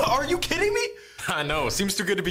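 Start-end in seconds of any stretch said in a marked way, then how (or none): 0.1-0.8 mock amazement. Customer amazed by bread.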